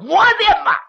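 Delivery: high, loud gasp of shock; shocked outcry. Acted. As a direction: cry out in horror.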